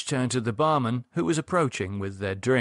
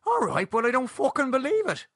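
high-pitched incredulous protest; lightly exasperated, voice pitched up; disbelieving protest; 14 semitones higher than usual. A narrator's reading. Shocked at news of friend's murder.

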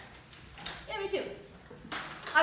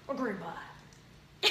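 low, slow, soft crooner drawl; drawn-out, deep celebrity impression; 8 semitones lower, 13 dB quieter, much slower than usual. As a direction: murmur it like a rock-and-roll idol.

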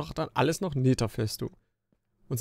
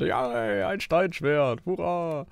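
sarcastic cheer; 6 semitones higher, slower than usual. Real, not acted. Another useless stone sword drop.

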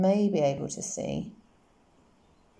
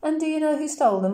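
amused and a little louder and higher; smiling policeman; 10 semitones higher, 5 dB louder than usual. A narrator's reading. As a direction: hold back a laugh at the child. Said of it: "hear the smile in his voice".

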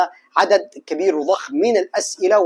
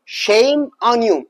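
very slow, every word drawn out; slow drill; much slower than usual. Shown as a demonstration. Teacher drilling a phrase for students, very slow. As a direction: spell out each word slowly for the learner. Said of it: "تحفظها كده مع بعض".

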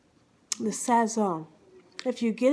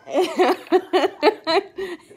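bursting out laughing, high and loud; real uncontrollable laugh; helpless giggling; 6 semitones higher, 5 dB louder than usual. Real, not acted. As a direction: crack up mid-story. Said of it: "I can't believe I'm cracking up".

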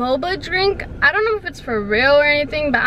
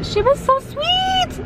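squealy gushing, much higher; gushing, high-pitched; slower than usual. Real, not acted. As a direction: gush like a fangirl. Gushing over barista, gasp.

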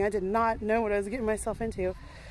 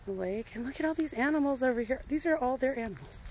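ordinary talk, nothing marked

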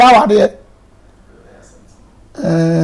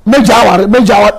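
voiced spouse; 2 semitones higher, 5 dB louder, much faster than usual. Voicing a spouse quitting.

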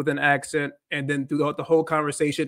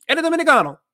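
much higher and louder, asking in surprise; curious question; 11 semitones higher, 6 dB louder, faster than usual. Retold or imitated. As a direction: ask in disbelief if he's Dominican. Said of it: "some people can be like".

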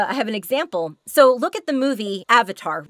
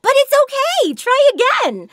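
much higher and louder, beaming even at a wrong answer; smiling kid-game voice; 13 semitones higher, 5 dB louder than usual. Shown as a demonstration. Game voice cheering a child after a wrong answer, smiling high. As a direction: keep smiling while you tell them to try again. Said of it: "Yeah, I'm still smiling".

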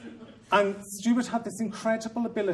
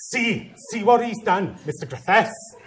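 accusing teacher; 5 semitones higher, 6 dB louder than usual. Retold or imitated.

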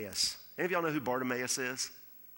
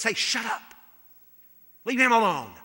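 loud, higher, annoyed shushing; crowd shouting him down; 8 semitones higher, 11 dB louder than usual. Acted. Crowd silencing the blind beggar, loud irritated shout. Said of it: "what the crowd does".

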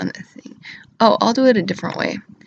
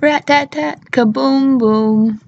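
higher rat-tat-tat, then loud drawn-out boom; imitated gunfire; 5 semitones higher, 4 dB louder than usual. Acting out the Second Amendment, mimicked guns and bombs. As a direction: mimic gunfire and a slow explosion.

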